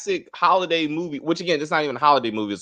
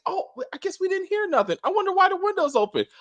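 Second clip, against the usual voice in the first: high-pitched, softer feigned innocence; mock clueless characters; 12 semitones higher, 3 dB quieter than usual. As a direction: play them oblivious in a high voice. Mocking film characters' clueless reaction.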